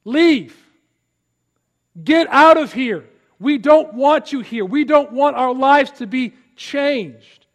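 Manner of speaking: loud, high order to leave; angry dismissal. Retold or imitated. Townspeople telling Jesus to go, angry. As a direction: drive him out of town. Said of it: "what do they say to Jesus".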